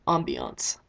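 low, proud Ambiance; savoring the word. Shown as a demonstration. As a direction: relish Ambiance as a fancy vocab word. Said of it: "Brownie points for using a vocab word".

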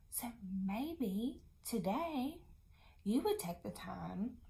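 slow soft whisper. Shown as a demonstration. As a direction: lean in and whisper it. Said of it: "whispering to children".

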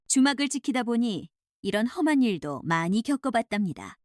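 plain reading voice

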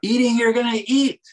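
"Eating, you're gonna eat" said high and loud, both "eat" words punched; emphatic doubling. Shown as a demonstration. Scholar showing how the doubled verb sounds, punchy stress. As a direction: insist on the food, hitting "Eating" and "eat". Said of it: "I feel the emphasis there".

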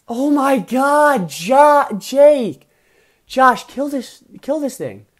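high, loud, drawn-out exclamation; loud exasperated wail at a friend; exasperated yell. Real, not acted. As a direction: cry out in sheer frustration. Exasperated at opponent's stalling.

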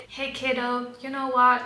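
ordinary delivery

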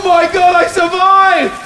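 excited shout. Real, not acted. Survived the fall, shouting.

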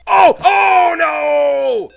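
high anguished cry; dismayed shout. Real, not acted. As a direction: wail at the loss. Loses a life.

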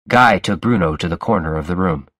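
usual speaking voice

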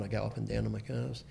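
usual speaking voice

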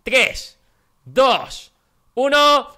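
hyped countdown. Real, not acted. Countdown before the jump.

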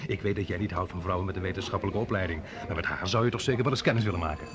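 usual speaking voice

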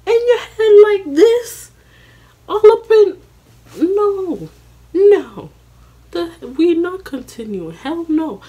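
high, loud, shrieking refusal; can't believe he wants to keep going; furious high-pitched protest; outraged disbelief. Retold or imitated. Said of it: "I'm like".

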